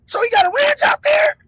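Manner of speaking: high-pitched retold question of disbelief; incredulous. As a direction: pitch it way up, amazed. Retold amazed question about the ranch.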